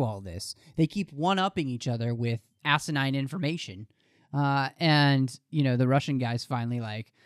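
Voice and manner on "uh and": usual speaking voice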